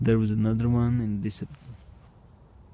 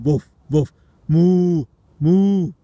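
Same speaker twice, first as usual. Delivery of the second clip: loud, high woofs rising into mooing; bark, then moo loud at the worms; dog then cow noises; 7 semitones higher, 9 dB louder, slower than usual. Pretend.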